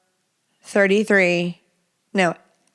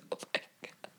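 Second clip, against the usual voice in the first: breathless whisper; real whispered relief; 21 dB quieter, much faster than usual. Real, not acted. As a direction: whisper it in shock. After killing spider.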